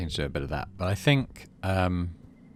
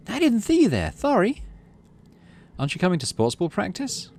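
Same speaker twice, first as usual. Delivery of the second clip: put-on high, light girlish voice; young girl voice; 12 semitones higher than usual. Classmate apologising after bumping into the player, high girl voice. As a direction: voice her brightly, a bit apologetic.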